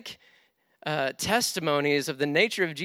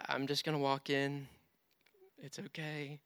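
softer, hushed calming of himself; quiet self-soothing; 6 dB quieter than usual. Retold or imitated. Preacher retelling how he calmed himself after the road rage, quietly self-soothing. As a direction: talk yourself down under your breath.

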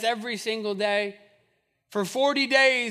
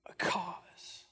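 Is there not spoken slowly, then a cause whispered; 5 semitones lower, 13 dB quieter than usual. Shown as a demonstration. Pastor teasing the congregation, whispering the last words. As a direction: drop to a breathy whisper on a cause. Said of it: "why are you yelling at us today".

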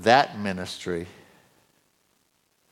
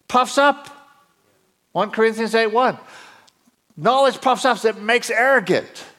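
high, raised voice building in excitement; excited preacher voice; 14 semitones higher, 8 dB louder than usual. Real, not acted. Preacher urging the congregation they need no more education, excited high-pitched preaching. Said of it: "My voice goes up when I get excited".

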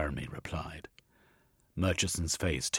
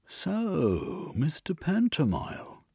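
high, smug drawn-out greeting; gloating inspector voice; 11 semitones higher, slower than usual. Acted. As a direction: savour the name like a cat with a mouse.

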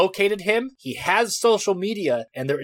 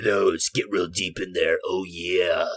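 much lower, softer, booming bass voice; deep voice; 11 semitones lower, 4 dB quieter than usual. Pretend. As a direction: drop the voice way down for the joke. Host jokes about diving deep.

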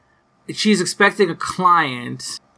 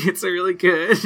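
normal talking voice

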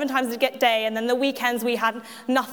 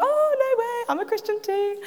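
much higher, eager squeal of delight; delighted surprise; 10 semitones higher than usual. Retold or imitated. To the two boys, thrilled high-pitched excitement. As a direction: gush at finding fellow Christians. Meeting boys from local church.

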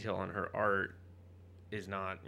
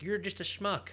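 mocking; 8 semitones higher than usual. Mocks the character as schmuck.

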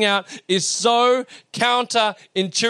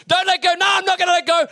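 panicked exchange; 12 semitones higher, 3 dB louder than usual. Retold or imitated. Son pleads, father promises during countdown.

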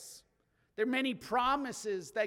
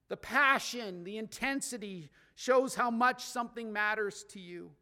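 usual speaking voice

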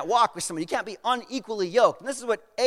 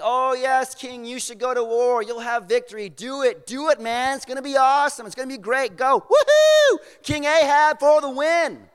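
loud, higher cheer building to a whoop; cheering prophets; 4 semitones higher, 5 dB louder than usual. Retold or imitated. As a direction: hype up the king like a crowd of yes-men. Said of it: "they're all saying".

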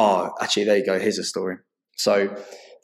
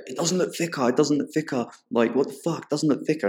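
higher-pitched mock Karen complaining; 6 semitones higher than usual. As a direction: whine it like an entitled Karen. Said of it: "full Karen".

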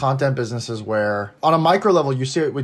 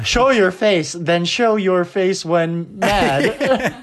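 high, slow text-to-speech voice; TikTok text reader; 6 semitones higher, much slower than usual. Shown as a demonstration. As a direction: read it like the TikTok robot voice. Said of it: "like the TikTok text reader".

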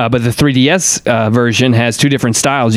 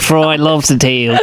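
bad Australian accent; slower than usual. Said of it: "maybe the worst accent I've ever heard".

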